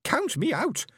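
high alien shriek; shrill protesting alien cry. A narrator's reading. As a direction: shriek the refusal. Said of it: "shrieked the Fenobe".

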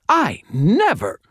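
high, loud, drawn-out indignation; indignant protest. Acted. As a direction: protest in outrage until cut off. Accused cousin protests, gets cut off.

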